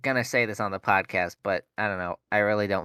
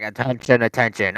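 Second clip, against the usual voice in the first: louder repeated warning call; imitated alarm announcement; 5 dB louder than usual. Alarm going off yet again, mimicked announcement voice. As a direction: mimic the recorded fire warning. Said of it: "it's like".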